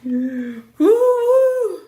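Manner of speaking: high, loud whoop of joy; joyful woo. Real, not acted. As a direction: whoop like you just won. Cheer after win.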